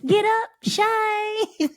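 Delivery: put-on high, slow child's shout; haunting voice. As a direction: drag it out like a kid's alarm. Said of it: "your wake-up alarm".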